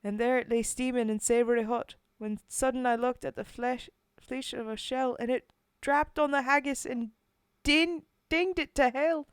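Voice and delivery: loud, rousing Scottish recital; Scottish accent. Acted. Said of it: "do any more Scottish".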